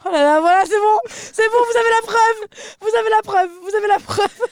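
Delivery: high, loud crowing at the audience; gleeful triumphant shout. Real, not acted. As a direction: gloat loudly, laughing.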